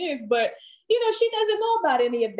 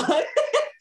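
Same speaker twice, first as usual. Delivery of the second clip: high, open laugh at herself; real burst of laughter. Real, not acted. Laughing at own song request.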